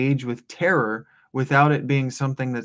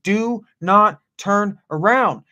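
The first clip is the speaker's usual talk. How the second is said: God's order retold, "Do not turn around" slow and forceful; emphatic divine command; 8 semitones higher, 6 dB louder, slower than usual. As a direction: DO NOT TURN AROUND spaced out slowly, high and loud. Quotes God's command to Job.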